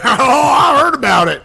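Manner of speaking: loud teasing echo; mock shout. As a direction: shout it back, laughing.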